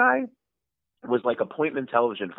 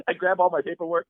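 usual speaking voice

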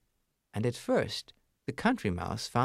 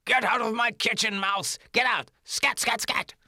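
higher, louder shooing shout; angry chef yell; 11 semitones higher, 4 dB louder than usual. Acted. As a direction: chase the mouse off with a broom.

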